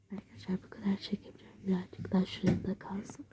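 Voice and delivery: quick hushed whisper; fast whisper. Real, not acted. Girl hiding, whispering quickly to viewers.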